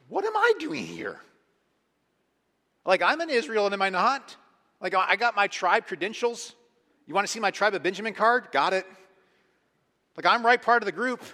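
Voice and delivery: high, loud mock incredulity; hammed-up disbelief; indignant protest. Acting out Paul's indignant protest.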